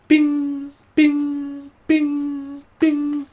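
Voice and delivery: high, far-off mimicked pings of a notification; phone ping imitation. Imitating a phone pinging with new messages, high pings.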